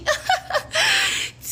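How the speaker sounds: high giggle mimicking the other girl; imitating the other girl's laugh; mocking laugh. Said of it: "the bitch laughs".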